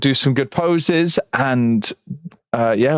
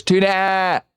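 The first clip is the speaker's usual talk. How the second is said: London slang; 6 semitones higher than usual. Retold or imitated. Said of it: "all you hear is".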